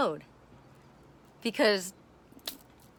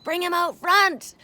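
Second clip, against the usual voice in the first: mock Ashley; 9 semitones higher, 7 dB louder, slower than usual. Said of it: "Ashley's like".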